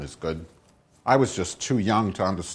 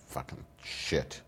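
muttered curse; 7 semitones lower, 14 dB quieter, slower than usual. Real, not acted. Muttering while off-mic.

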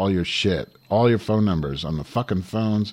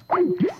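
squealing little laugh; quick high laugh; high-pitched giggle. Real, not acted. High laugh after insult.

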